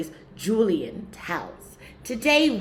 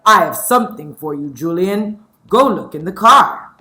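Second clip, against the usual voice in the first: lightly voiced dad, louder; a hint of a father; 14 dB louder than usual. Father announcing a birthday present to his son, lightly voiced. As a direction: call him to the car like a proud father. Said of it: "My father came home from work and said".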